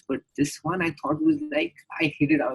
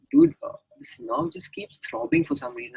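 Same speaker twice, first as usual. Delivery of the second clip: mock panic; 13 semitones higher than usual. Panicked high asking friend.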